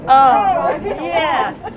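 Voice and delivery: high, loud eager agreement; excited oh yes. Real, not acted. Woman joining friend's joke, eagerly agreeing. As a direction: burst in with delighted agreement. Friends joking about flower pots.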